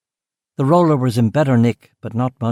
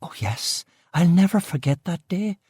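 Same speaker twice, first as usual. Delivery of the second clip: higher Belfast woman, hushed on shocking; gossiping West Belfast cook; 7 semitones higher, 4 dB quieter than usual. Acted. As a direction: confide the scandal in Belfast tones. Aileen recalls the day.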